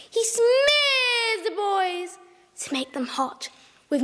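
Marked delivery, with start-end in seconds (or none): 0.1-2.2 slow, drawn-out savoring; slow relish. A narrator's reading. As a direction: savor each word slowly. Gleeful villain detail.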